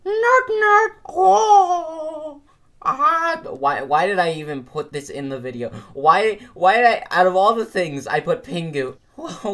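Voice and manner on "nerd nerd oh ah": mocking taunt. Teasing taunt.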